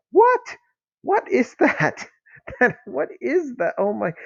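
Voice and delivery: squeaky high incredulous what; can't believe what he's reading, pitch shooting up; baffled disbelief. Real, not acted. Reviewer baffled by a comic's dull dialogue, high incredulous squeak.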